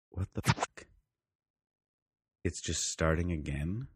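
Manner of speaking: quiet, slow, baffled inner voice; mock stunned reaction, softly; retold hushed disbelief. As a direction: say it under your breath, drawn out. Retold inner reaction mid-movie.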